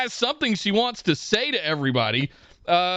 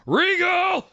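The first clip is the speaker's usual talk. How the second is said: higher mock yell of a name; 12 semitones higher than usual. Joe calling out Regal from the back, mock yell. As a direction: call out the name from backstage. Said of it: "I would've had Joe yell".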